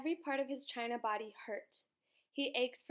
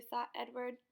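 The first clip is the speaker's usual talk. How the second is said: plain reading voice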